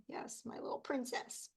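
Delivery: soft doting whisper; tender whisper. Real, not acted. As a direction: whisper it fondly over her photo. Doting on granddaughter screensaver.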